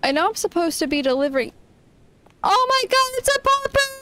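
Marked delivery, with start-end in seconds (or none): 2.4-4.0 excited squeal. Real, not acted. Player spots a puppy in the game, excited squeal. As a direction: squeal with delight, rushing the words.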